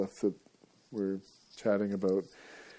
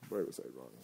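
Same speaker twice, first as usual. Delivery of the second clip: low, much softer repeat; quiet aside; 9 semitones lower, 11 dB quieter, faster than usual. Shown as a demonstration. Host echoing himself to listeners in a low aside. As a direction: mutter it to yourself, dropped low.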